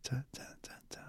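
breathy whispered syllables. Retold or imitated. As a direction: whisper the rhythm softly. Mimicking the ghost recording's rhythm.

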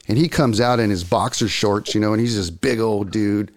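ordinary delivery

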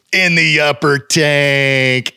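very slow, stretched-out words; long, drawling prank reveal; drawn-out punchline. Retold or imitated. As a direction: draw every word out long.

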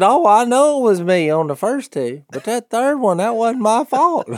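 put-on high, defensive old man voice; pleading his innocence on the third; old man excusing himself.

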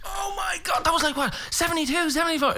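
very high, astonished exclamation; acted amazement. Singer remembers pub gigs with Dad, amazed. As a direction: shoot the pitch right up in disbelief.

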